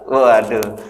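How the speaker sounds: ordinary talk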